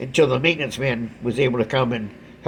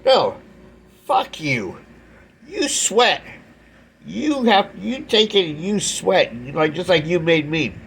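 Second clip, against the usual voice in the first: retold fuck you; 5 semitones higher, slower than usual. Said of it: "this is kind of my fuck you".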